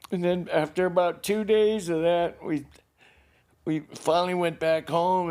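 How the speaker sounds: real tearful voice. Real, not acted. Man recalling the return home, holding back tears. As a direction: carry on through tears, haltingly.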